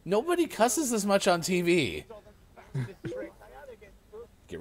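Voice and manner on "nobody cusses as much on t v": normal talking voice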